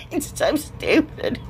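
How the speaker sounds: voiceless, wheezy real laughter; laughing. Real, not acted. Crafter giggling helplessly over her humps, laughing. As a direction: laugh so hard the words barely come out. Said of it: "I can't stop laughing".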